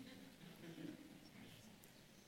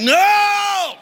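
high, loud shouted no; mock panicked shout; horrified no; 11 dB louder than usual. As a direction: blurt it in mock horror. Congregation's mock horror at snow.

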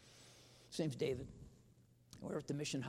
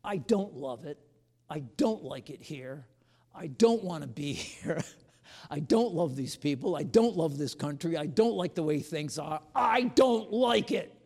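rising, louder honest outburst to God; anguished confession; 2 semitones higher, 10 dB louder than usual. Retold or imitated. Preacher confesses to the Lord, loud and pained. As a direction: pour out the frustration, building. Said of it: "I just".

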